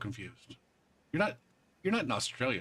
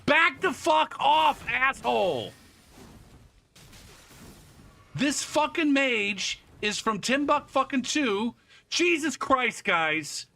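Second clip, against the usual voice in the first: panicked furious yelling; scared and angry; 12 semitones higher, 6 dB louder, slower than usual. Real, not acted. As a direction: yell at the attacker in panic. Attacked by mage in game.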